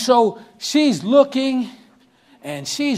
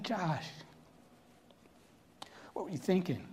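quiet, much softer, gently asking; soft question; 3 semitones lower, 18 dB quieter than usual. Retold or imitated. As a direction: ask him gently, not scolding. Father speaks softly to son.

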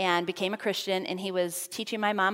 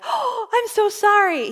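high, loud gasped apology, drawn out; acted-out alarmed sorry, slow and much higher; startled apology; 13 semitones higher, 9 dB louder, slower than usual. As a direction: gasp and apologize, caught off guard.

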